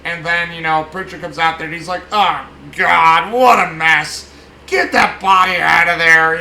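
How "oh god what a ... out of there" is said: loud, exasperated barking of orders; a fussy boss yelling at his men to clear the mess; irritated yell. Retold or imitated. Pritchett yelling at men pulling body from fire, irritated shout.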